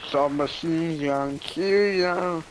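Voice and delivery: slow, drawn-out silly put-on voice; odd character voice. Kid echoing a gun name to a friend in an odd put-on voice. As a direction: stretch the words out in a goofy voice. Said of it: "don't know who that voice was".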